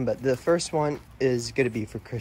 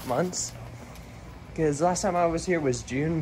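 usual speaking voice

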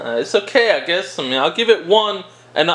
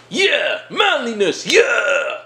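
hammed-up he-man shout; higher, exaggerated manly battle cry; mock macho cheer; 4 semitones higher, much slower than usual. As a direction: roar it like a parody tough guy. Mock burst of testosterone, manliness chant.

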